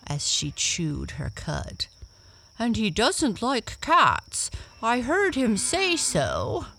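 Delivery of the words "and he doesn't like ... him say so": higher, louder animal voice; high storybook horse voice; brown horse. A narrator's reading. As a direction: put on a high-pitched talking horse. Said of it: "spoke a brown horse".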